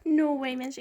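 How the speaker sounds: ordinary delivery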